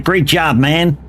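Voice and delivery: happy American tourist thanking the driver; American accent. Retold or imitated. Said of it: "take your accent again".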